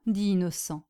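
usual speaking voice

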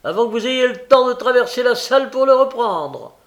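loud, high hawker's spiel; pedlar's patter. A narrator's reading. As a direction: build the list insistently, stretching each item. Pedlar's sales patter in story.

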